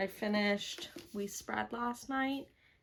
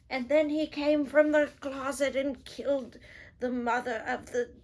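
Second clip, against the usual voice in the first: louder, higher put-on spoiler voice; mock spoiler blurt; 4 semitones higher, 4 dB louder than usual. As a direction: act out blurting a big plot twist.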